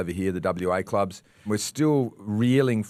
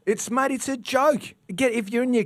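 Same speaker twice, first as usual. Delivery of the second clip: much higher fired-up exclamation; excited rant; 13 semitones higher than usual. Acted. Host to co-host, high-pitched rant. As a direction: push it high and heated. Ranting about the AFL.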